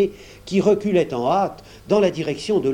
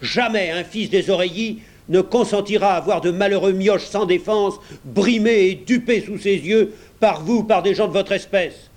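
raised, higher and louder fury; indignant tirade; 5 semitones higher, 3 dB louder than usual. A narrator's reading. Pat denounces the clerk, angry raised voice. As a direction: keep hammering him with outrage.

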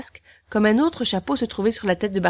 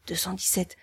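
whispered count; 8 dB quieter than usual. A narrator's reading. Counting hats softly.

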